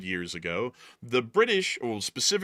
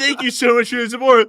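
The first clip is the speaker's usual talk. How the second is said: high, loud laughing imitation of a strangled voice; imitating a co-host wheezing through a thank-you; mock choked thanks; 12 semitones higher, 12 dB louder than usual. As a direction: parody him choking out the thanks. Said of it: "you went".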